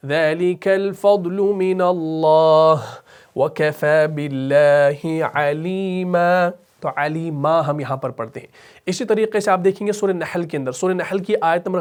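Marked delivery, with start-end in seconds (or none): 0.1-6.5 slow, chanted tilawat; slow measured Quranic chant; Quran recitation. Shown as a demonstration. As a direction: recite melodically and slowly. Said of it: "recites verse from screen".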